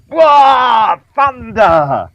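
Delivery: hammed-up superhero yell; mock Thor battle cry. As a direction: bellow it like a god of thunder. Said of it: "Thor goes".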